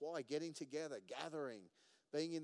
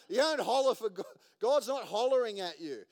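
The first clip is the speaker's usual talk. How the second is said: much louder and higher, hammering the point home; booming preaching; 7 semitones higher, 13 dB louder than usual. Real, not acted. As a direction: lift the voice and boom at the congregation. Preacher laughs, then booms.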